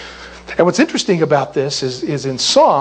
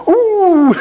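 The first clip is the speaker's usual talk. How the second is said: high, loud whoop; hammed-up cry of surprise; startled "ooh"; 14 semitones higher, 6 dB louder than usual. God as he leaps at him, playful high whoop.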